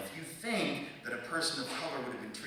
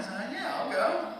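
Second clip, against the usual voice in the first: high pubescent squeak; voice cracking; 11 semitones higher, 4 dB louder than usual. Retold or imitated. Nervous driver agreeing to join the officer, voice cracking high. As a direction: let it jump up like a cracking teenage voice. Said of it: "my voice cracked like I was thirteen".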